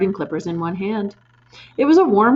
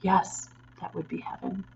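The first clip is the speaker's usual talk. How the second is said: soft, hushed longing; softly; 8 dB quieter than usual. A narrator's reading. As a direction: say it gently, almost dreaming. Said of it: "Miss Honey said softly".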